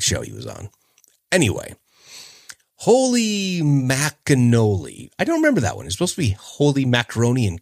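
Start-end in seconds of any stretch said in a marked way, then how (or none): 2.8-5.1 slow, drawn-out, a bit higher; Punky Brewster catchphrase. Retold or imitated. Host performing Punky Brewster's catchphrase slowly. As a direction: savor it like a kid's exclamation. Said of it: "Punky Brewster".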